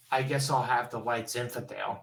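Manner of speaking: small, resigned customer's reply; meek acceptance. Acted. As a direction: quietly settle for what's offered. Deflated customer settles for wine.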